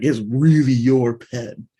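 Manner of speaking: slow, loud emphasis on "really your"; stress on REALLY YOUR. Shown as a demonstration. Teacher driving the emphasis home, stress on "really your". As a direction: lean hard and long on "really your". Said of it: "it's emphasis".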